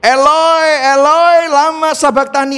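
high, loud cry of forsakenness; acted anguished shout; shrieking cry. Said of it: "dia menjerit".

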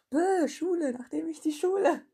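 laut, höher, genervtes Bäh; genervt über sich selbst. Real, not acted. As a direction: sich selbst anmeckern nach dem Versprecher. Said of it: "ärgert sich über Versprecher". (laut und genervt über den eigenen Fehler)

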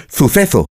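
"suceso" with lisped th sounds; overdone Castilian Z. Shown as a demonstration. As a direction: say "suceso" as "thu-THE-tho". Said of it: "no puedo decirlo con Z".

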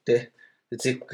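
usual speaking voice

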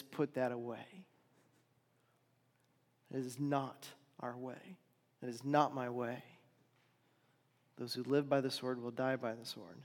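soft, firm correction; calm but firm, set him right gently; quiet rebuke. Acted. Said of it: "And he rebukes Peter".

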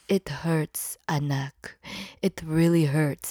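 low, soft, whispered complaint; pained whisper. Retold or imitated. Mom telling her daughter about the pain, low and whispered. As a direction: whimper it under your breath.

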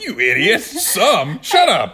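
high, loud mock scolding of herself; mock self-scolding. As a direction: scold yourself in jest. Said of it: "I was like".

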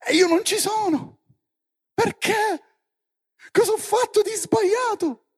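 high, loud wail of grievance; indignant protest. Acted. Joshua complains he was left out, high indignant shout. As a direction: cry out as the one unfairly left out.

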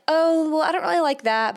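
politely turning it down; mild displeasure. Pretend. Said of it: "you'll be able to say".

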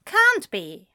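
high, loud contradiction; quick, insistent protest; stubborn denial. A narrator's reading. Acted bickering between two friends.

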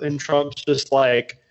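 ordinary delivery

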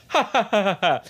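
high, spaced-out fake laugh, falling; hammed-up taunting ha-has; fake mocking laugh. Imitating Ethel taunting Betty, fake mocking laugh.

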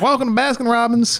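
high-pitched customer greeting; perky shop clerk. Acted. Clerk greeting an invader at Baskin Robbins, high perky voice. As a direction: chirp it like a counter worker.

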